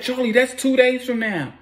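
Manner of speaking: high-pitched imitation of a protesting woman; acted protest. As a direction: voice jumps way up in mock disbelief. Woman protests the short deadline.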